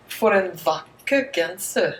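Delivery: slow Norwegian For en vakker genser; Norwegian pronunciation. Shown as a demonstration. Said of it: "One more time".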